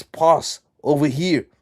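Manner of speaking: acted teammate's drawn-out shout; calling for a pass. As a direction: call across the pitch for the ball.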